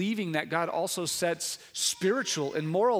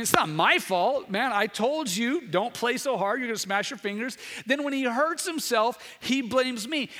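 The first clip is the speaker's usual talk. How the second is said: preaching at full force; 6 semitones higher, 3 dB louder than usual. Real, not acted. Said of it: "preacher voice rising".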